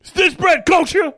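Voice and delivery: loud, high comic tough-guy shout; Mister T. Acted. Comic imagining a famous tough guy, mock shout. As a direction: yell it as a hammed-up tough guy. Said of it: "Mister T doesn't curse".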